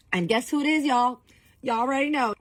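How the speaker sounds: high, loud mock-dramatic build to the reveal; exasperated reveal. Real, not acted. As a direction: roll your eyes at who is there. Teasing reveal of unwanted guest.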